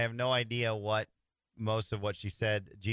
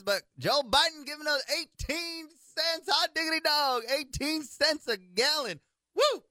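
southern twang. Pretend. Host sarcastically cheering eighteen cents in a Southern twang. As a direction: whoop it up like a country yokel in a Southern accent. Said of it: "That's why I'm talking like this".

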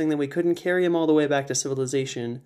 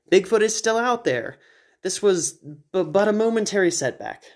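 higher, haughty; Edwin's eccentric voice; 6 semitones higher than usual. Acted. Edwin refusing to leave, haughty. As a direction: dismiss it grandly.

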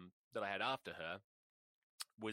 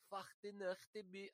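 softer retry of the fake German accent that slips toward Swedish; half-hearted fake German accent; 11 semitones higher, 5 dB quieter than usual. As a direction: try the fake German line again, less sure. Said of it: "sounding Swedish or something".

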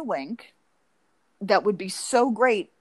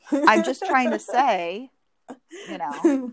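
higher, louder feigned innocence; mock bewildered husband; 4 semitones higher, 5 dB louder, much slower than usual. Therapist plays husband facing wife with kitchen knife, mock bewilderment. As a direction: play a baffled husband protesting.

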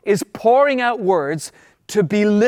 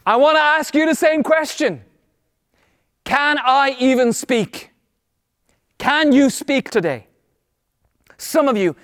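rousing preaching; 5 semitones higher than usual. Real, not acted. Preacher challenging people paralyzed by words, rousing exhortation. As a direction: urge the congregation on with ringing emphasis.

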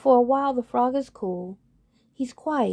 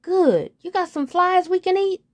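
high, louder pal voice; frog friend; 10 semitones higher, 5 dB louder than usual. Acted. Frog's friend asking for flies, higher and louder. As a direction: toss it back like a hungry buddy.

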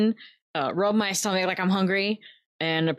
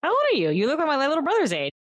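put-on curious, leaning toward the kid; pitched-up friendly question; player character voice; 9 semitones higher, faster than usual.

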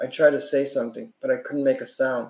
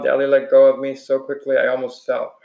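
plain reading voice

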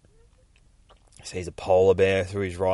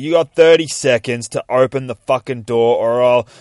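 loud, higher-pitched threat; yelling; 5 semitones higher, 12 dB louder than usual. Retold or imitated. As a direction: shout an ultimatum at the door. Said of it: "he's fucking out the front still yelling".

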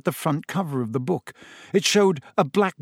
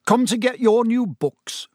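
abrupt; 8 semitones higher, 3 dB louder than usual. A narrator's reading. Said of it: "he said abruptly".

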